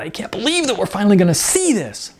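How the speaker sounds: high, thrilled squeal of a voice; excited shriek. A narrator's reading. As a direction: gush it out like she's been waiting forever. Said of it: "Melanie shrieked".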